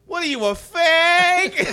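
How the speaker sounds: high, loud, slow mocking voice; little inner voice. Bill Burr's inner voice mocking him, high slow taunt. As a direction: drag out the mocking question. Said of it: "a little voice behind goes".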